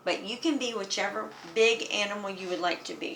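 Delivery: ordinary talk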